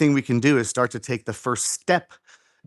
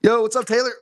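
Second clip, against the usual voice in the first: excited greeting; 8 semitones higher, faster than usual. Real, not acted. Greeting a friend mid-workout.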